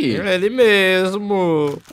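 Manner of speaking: slow, loud, very high showy answer; drawn-out proud reply. Pretend. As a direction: stretch it out like a proud boast. Proud answer to TikTok question. (resposta orgulhosa e arrastada)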